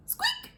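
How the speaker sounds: a very high, soft mouse squeak. Acted.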